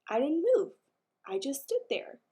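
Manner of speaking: ordinary delivery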